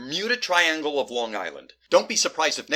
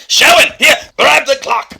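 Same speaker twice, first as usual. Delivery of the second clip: high, very loud call; urgent shout; 13 semitones higher, 14 dB louder than usual. Acted. Doctor passing the clock, urgent shout. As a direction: yell to an ally mid-scuffle.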